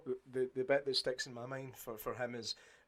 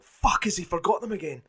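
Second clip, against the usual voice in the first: mock panic, pitched up and louder; half-acted alarm at the screen; panicked viewer; 8 semitones higher, 7 dB louder than usual. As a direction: fret out loud like a worried viewer. Viewer panicking over lost papers.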